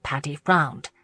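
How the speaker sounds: ordinary delivery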